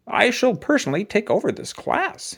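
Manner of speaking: high, stiff, proper announcement; pompous principal. A narrator's reading. Principal Blansworth tells the class he'll take over, pompously. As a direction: puff yourself up like a self-important principal.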